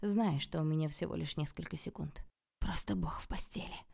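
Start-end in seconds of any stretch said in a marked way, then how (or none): 2.6-3.8 low, gloating whisper; hot whisper. A narrator's reading. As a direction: whisper it to taunt a rival. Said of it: "жарко зашептала я ей на ухо".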